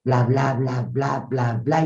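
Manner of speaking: slow, low, drawn-out delivery; plodding mock-solemn drone; slow, halting speaker. Shown as a demonstration. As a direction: leave long pauses and let the energy sag. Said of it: "como te dice la pausada".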